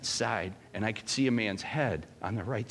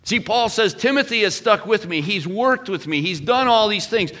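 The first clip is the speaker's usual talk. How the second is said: much higher and louder, sustained and fervent; full-force preaching; 10 semitones higher, 9 dB louder than usual. Acted. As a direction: preach it to the back row.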